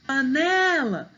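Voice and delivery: PANELA slowly, with a long, high NE; calling the word. Shown as a demonstration. Teacher showing penultimate stress, calling paNEEla. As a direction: stretch and lift NE in panela. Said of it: "o né é mais forte".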